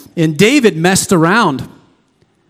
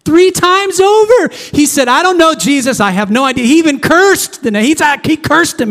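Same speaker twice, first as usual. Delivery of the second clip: rising preacher intensity; impassioned preaching; 9 semitones higher than usual. Real, not acted. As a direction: drive the betrayal home with fire. Preaching Peter's betrayal.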